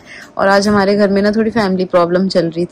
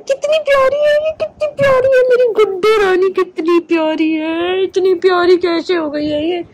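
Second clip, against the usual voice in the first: much higher, squeaky gushing coo; 10 semitones higher than usual. Real, not acted. Owner showing viewers her cute dog, squeaky gushing coo. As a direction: melt over how cute she is.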